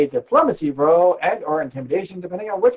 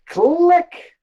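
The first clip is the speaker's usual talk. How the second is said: lock click; 14 semitones higher, 4 dB louder than usual. Acted. Lock sound effect.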